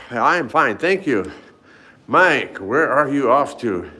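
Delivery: ordinary talk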